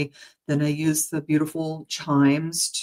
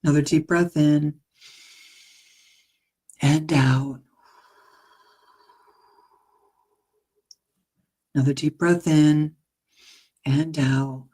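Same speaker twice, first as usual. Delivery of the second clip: unhurried breathing cues for the listener; guided meditation. Acted. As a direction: lead them gently into the breath. Leads a meditation.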